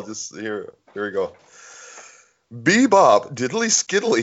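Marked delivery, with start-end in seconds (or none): 2.5-4.2 stifled giggles in the voice; fighting back laughter; laughing. Real, not acted. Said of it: "Got the giggles now".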